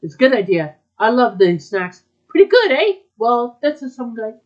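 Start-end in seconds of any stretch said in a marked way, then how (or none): 2.3-2.9 high, loud, pleased with itself; louder and higher; pleased boast. Acted. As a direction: show off a little. Boasting about the snacks.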